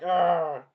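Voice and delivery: short loud frustrated ah; angry grunt. Retold or imitated. Hector caught out, loud angry ah. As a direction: grunt it out like an angry young man. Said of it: "Hector gets very angry".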